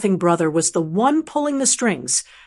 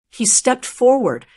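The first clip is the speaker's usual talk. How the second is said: plain reading voice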